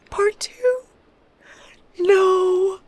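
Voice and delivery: very high squeak of disbelief; shocked dismay. Real, not acted. Shocked to reach part two.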